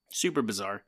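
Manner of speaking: ordinary talk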